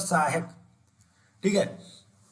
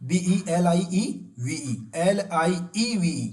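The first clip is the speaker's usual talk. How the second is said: each letter stretched out long; teacher dragging out the correct spelling; very slow spelling; much slower than usual. Shown as a demonstration. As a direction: dictate each letter very slowly. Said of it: "correct spelling क्या है दोस्तों?".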